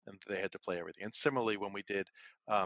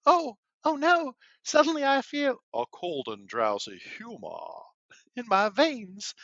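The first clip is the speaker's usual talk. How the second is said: put-on much higher, louder, slow Valley Girl Southern Belle accent; 14 semitones higher, 11 dB louder, slower than usual. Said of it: "half Valley Girl, half Southern Belle".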